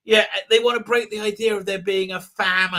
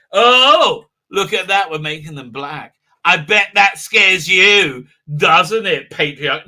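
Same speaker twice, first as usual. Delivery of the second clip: loud, hammed-up gloating oh, then a slow jeer; mock provocation, punched hard; mocking taunt; 6 dB louder, slower than usual. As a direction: sneeringly imitate someone scoring points. Mocking a writer's imagined taunt.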